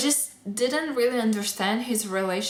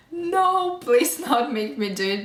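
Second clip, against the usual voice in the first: mock pleading boyfriend, higher and louder on "No"; whiny boyfriend; 4 semitones higher, 3 dB louder than usual. Boyfriend who hates Harry Potter begs not to go, mock whiny plea. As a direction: beg like a reluctant boyfriend, playfully hammed. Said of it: "He was like".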